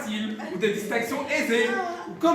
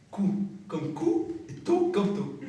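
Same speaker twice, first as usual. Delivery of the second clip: slow careful teaching; 3 semitones lower, 4 dB quieter, slower than usual. Shown as a demonstration. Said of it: "répétez".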